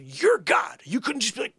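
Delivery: high, loud indignant challenge; comic high-pitched exasperation; exasperated protest. Acted. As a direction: challenge God, voice shooting up. Acted protest to God.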